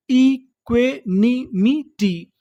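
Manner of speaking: "Equanimity" very slowly, each syllable apart; broken into syllables. Shown as a demonstration. Teacher reading the full word to learners, syllable by syllable. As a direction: sound out "equanimity" one beat at a time. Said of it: "टुकड़ों में तोड़-तोड़ के पढ़ना है".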